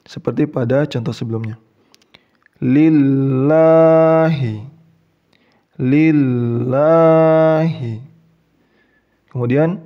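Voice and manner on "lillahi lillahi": Lillahi, very slow, the "ll" thin and held; slow, louder Quran chant of Lillahi; light lam. Shown as a demonstration. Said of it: "L biasa saja".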